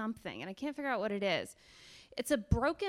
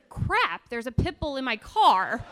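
louder, mock-alarmed outburst; retold alarm; 9 dB louder, slower than usual. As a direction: act out a sudden startled realization. Sudden alarm at dog in car.

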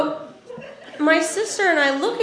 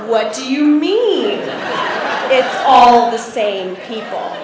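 slow, spelled-out disbelief; incredulous dad; slower than usual. Retold or imitated. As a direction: imitate a baffled dad demanding an explanation.

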